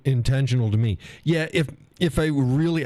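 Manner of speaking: ordinary talk, nothing marked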